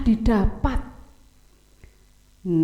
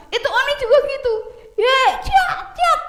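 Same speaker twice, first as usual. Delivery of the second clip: sword play sounds; 12 semitones higher than usual. Acted. Narrator playing sword sound.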